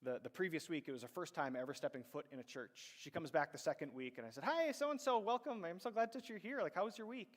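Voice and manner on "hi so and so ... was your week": much higher, louder gushing greeting; bubbly welcome. Retold or imitated. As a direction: greet her like you're thrilled she came back. Youth leader greets new student.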